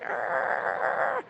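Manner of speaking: high, strangled cry of tension; frustrated growl. Retold or imitated. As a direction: let the built-up tension burst out. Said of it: "just like".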